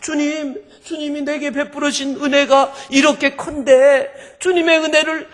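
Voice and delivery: high, loud sobbing plea, acted out; imitated weeping worshipper; wailing tearful prayer. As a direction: cry out to the Lord in a Chungcheong lilt. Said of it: "엉엉 울면서 충청도 사람인데".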